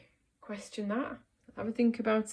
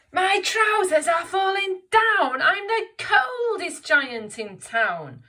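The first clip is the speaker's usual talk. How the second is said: high, loud, slow wailing giant; George complaining; 12 semitones higher, 13 dB louder, slower than usual. Acted. George the giant wailing that his trousers are falling down. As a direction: moan like a cold giant.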